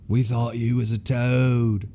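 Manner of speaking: slow, drawn-out movie quote; imitating movie character. Quoting a favorite theater scene to a friend, slow imitation. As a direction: draw it out like a quoted film line. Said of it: "when he's like".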